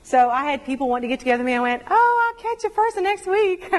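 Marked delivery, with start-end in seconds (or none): 1.9-3.7 mock cheery brush-off. Mock-polite brush-off of friends.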